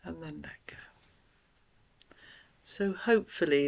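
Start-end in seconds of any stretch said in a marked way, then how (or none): 0.1-0.8 guided breathing. Acted. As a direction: gently talk them through each breath, leaving room to breathe. Leads deep breathing exercise.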